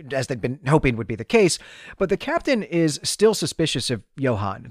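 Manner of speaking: ordinary talk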